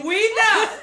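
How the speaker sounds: very loud, high, laughing jab; teasing shout. Real, not acted. Co-host teasing amid laughter.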